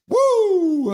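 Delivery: high, loud whoop; woo cheer. Retold or imitated. Streamer voicing chat, loud high whoop. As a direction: whoop it out like a fan. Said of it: "Sensei D U I is like".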